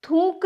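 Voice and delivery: थूक said as thoonk, nasal long vowel; nasalised thoonk in place of थूक; wrong nasalised pronunciation. Shown as a demonstration. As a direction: put a stray n sound into थूक. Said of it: "नहीं होता".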